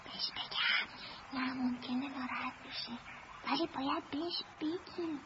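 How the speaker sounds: very soft, low conferring whisper; secretive whisper. Acted. Child debating whether to tell her, whispering secretly. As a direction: whisper so the grown-up won't overhear.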